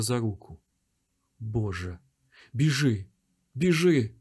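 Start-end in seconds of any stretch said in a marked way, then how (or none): 1.4-4.1 frantic rising urge to flee; panicked. A narrator's reading. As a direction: push him to run, rising. Father fleeing with son.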